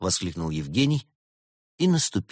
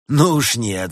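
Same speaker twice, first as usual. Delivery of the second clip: sinister gloating; 5 semitones higher, 7 dB louder, slower than usual. A narrator's reading. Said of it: "зловеще расхохотался делец".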